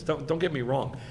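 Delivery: normal talking voice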